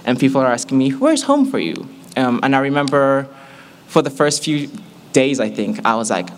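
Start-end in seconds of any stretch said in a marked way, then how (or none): 0.9-1.9 very high, keen questioner; eager question. Retold or imitated. Classmates asking a newcomer about home, high and eager. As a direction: swoop up high, imitating a curious classmate.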